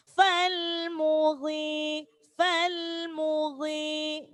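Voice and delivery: "Fal mughi" slowly, the i held one beat; slow recitation. Shown as a demonstration. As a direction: stretch the i in "mughi". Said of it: "maka dibaca matobi'i". ("fal mughi" dibaca mad thobi'i)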